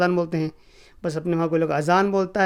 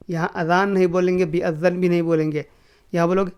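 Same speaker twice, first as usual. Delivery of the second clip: ordinary talk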